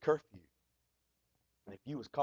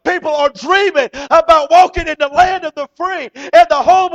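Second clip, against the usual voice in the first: loud, high, driving sermon voice; rising to a full preaching cry; preaching at full force; 9 semitones higher, 15 dB louder than usual. Acted. Preacher stirring the congregation, loud and high.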